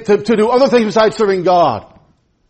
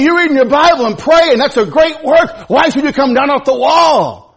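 higher and louder, rousing pulpit cry; full-force preaching; 8 semitones higher, 5 dB louder than usual. Acted. Preacher rallies the church to keep serving, rousing. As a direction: lift the congregation to the great work.

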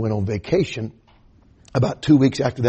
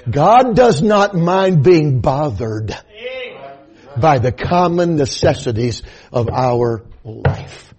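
loud slow preacher's cry; preaching; 3 semitones higher, 7 dB louder, slower than usual. Acted. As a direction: preach it out slowly at full force. Opens sermon at full force.

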